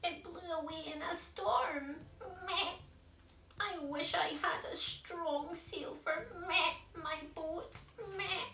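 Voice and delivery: high goat voice with bleats of meh; bleating goat. Acted. Goat tells George its sail blew away, bleating high. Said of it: "who was bleating loudly".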